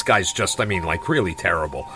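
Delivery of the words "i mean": ordinary delivery, nothing marked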